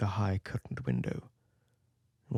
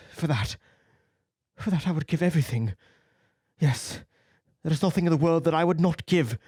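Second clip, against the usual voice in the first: put-on raised, pleading young man's lament; a vain youth bargaining his soul away; anguished young Dorian; 6 semitones higher, 10 dB louder than usual. A narrator's reading. Dorian begging before his own portrait, anguished.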